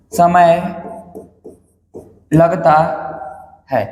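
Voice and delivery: word by word, long pauses between; slow dictation. Real, not acted. Teacher dictating notes for students to write, slow dictation. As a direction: dictate in separate, firm chunks.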